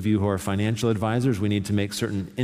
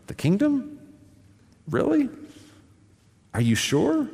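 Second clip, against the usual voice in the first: high-pitched doubtful questions, pitch climbing; hesitant, incredulous high voice with pauses between questions; insecure disbelief; 12 semitones higher than usual. Acted. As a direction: question it in a small, high, unsure voice. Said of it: "To feel weak and vulnerable and insecure".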